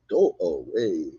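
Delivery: drawn-out, mocking snooty brush-off; snooty high schooler. Co-host mocking a wrestler who sounds high school, snooty. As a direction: imitate a snooty high school girl, stretched slowly. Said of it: "sounds like some snooty high school person".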